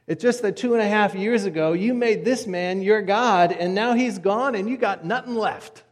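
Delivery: forceful, blunt telling-off; blunt confrontation. Retold or imitated. Priest bluntly confronts the woman.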